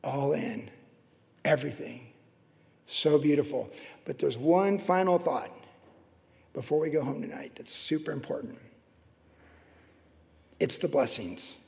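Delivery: quiet, reverent wonder; hushed awe. Real, not acted. Preacher hushed in awe over the marriage.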